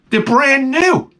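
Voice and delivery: high, slow put-on squeak; squeaky mock excuse. Defending his garage sale buy, high slow whine.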